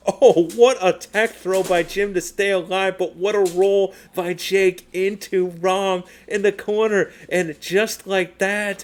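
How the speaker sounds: high, loud, breathless play-by-play; excited hype call. Real, not acted. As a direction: call the comeback like the crowd's on its feet. Climax of close match call.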